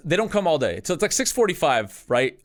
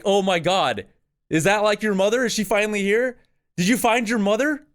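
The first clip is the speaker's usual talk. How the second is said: higher-pitched, lightly acted wonder; mildly excited, high questioning voice; retold amazement; 6 semitones higher than usual. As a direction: relive the amazed discovery, pitch lifted.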